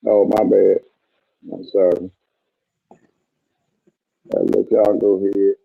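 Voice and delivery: muffled, underwater-sounding mic; muffled bass only. Real, not acted. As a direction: voice through a bad muffled mic. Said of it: "he just sound like he was underwater".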